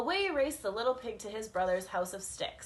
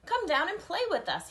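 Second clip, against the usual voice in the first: called; 7 semitones higher, 3 dB louder than usual. Acted. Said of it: "he called".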